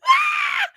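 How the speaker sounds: very high squeal of laughter; delighted shriek. Real, not acted. Real delighted squeal. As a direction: streamer shrieks at how funny the chat is. Cracking up at chat.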